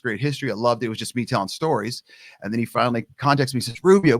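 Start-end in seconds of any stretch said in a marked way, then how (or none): 3.8-4.2 loud, high call of the name. Retold or imitated. Old teammate calling him on the phone, loud hail.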